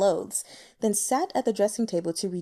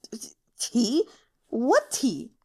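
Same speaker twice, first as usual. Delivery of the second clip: stuttering, terrified reply; a courtesan terrified by a bloody intruder; frightened stammer; 2 semitones higher, slower than usual. Acted. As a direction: stammer in fear. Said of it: "Scared out of her wits".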